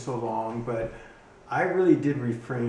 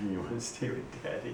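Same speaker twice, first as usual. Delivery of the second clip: much softer, laughing pet voice; soft dog talk; 8 dB quieter than usual. Real, not acted. Owner quietly asking his dog to stay with him. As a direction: murmur to the dog with a chuckle.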